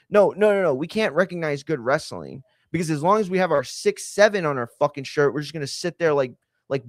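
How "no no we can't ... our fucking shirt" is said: ordinary talk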